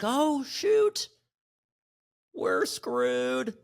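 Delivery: high voice dropping to a whisper; a squeaky gasp, then a hushed groan; mock panic. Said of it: "Now everybody's like".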